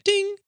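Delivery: high ringing mock chime; imitated cockpit chime. Pilot telling of a tripped breaker alert, imitated chime. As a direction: sing out a drawn-out alert ding.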